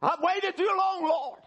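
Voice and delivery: high-pitched, urgent cry to God. Retold or imitated. Man at the altar calls out to God, crying out. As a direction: cry out to the Lord in desperation. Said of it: "He begins to cry out".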